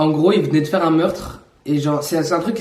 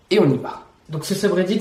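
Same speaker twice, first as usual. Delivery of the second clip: normal talking voice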